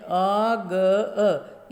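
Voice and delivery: आ ग अ drawn out sound by sound; slow classroom sounding-out; very slow spelling. Shown as a demonstration. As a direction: spell it out very slowly for children. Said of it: "क्या गया इसमें".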